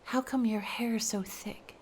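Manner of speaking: soft unvoiced riddle exchange; hushed riddles. A narrator's reading. Sailor and sea maiden whispering riddles.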